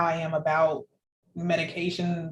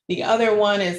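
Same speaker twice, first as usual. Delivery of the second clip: ordinary talk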